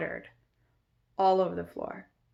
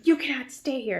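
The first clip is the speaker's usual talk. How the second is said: higher, louder retold refusal; imitated screech; 6 semitones higher, 5 dB louder than usual. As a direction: imitate an outraged woman laying down the law. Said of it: "like, screeches".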